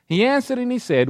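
usual speaking voice

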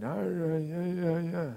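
weak dying man. Retold or imitated. Dying old man mumbling.

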